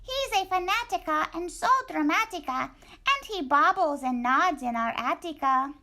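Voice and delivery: a tiny excited dog greeting his friend; squeaky little dog. Acted. Poquito Tito greeting Scapito, high squeaky voice.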